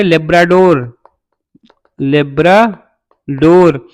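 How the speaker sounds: Labrador stretched out syllable by syllable; very slow, drawn-out Labrador; slow dictation. Shown as a demonstration. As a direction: break Labrador into long, slow pieces. Repeats the term for students.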